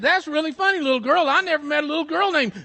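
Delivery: loud, high, quick teasing; big fellow's playful jibe; teasing big man. Acted. Big man teases girl.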